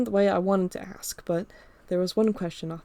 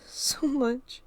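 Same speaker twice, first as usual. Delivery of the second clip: mumbled; 6 semitones higher than usual. Acted. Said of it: "she mumbled".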